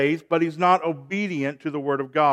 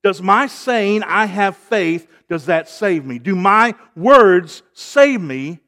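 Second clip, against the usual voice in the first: forceful preaching; 2 semitones higher, 7 dB louder than usual. Real, not acted. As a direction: press each question on the congregation.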